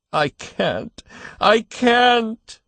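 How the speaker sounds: moaning. A narrator's reading. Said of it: "he moaned".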